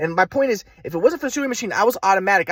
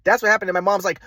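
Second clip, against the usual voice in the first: ordinary delivery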